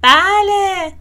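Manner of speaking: loud, high shout of yes; big storybook yell; excited shout. A narrator's reading. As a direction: shout it out, pitch up. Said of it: "فرانکلین فریاد زد".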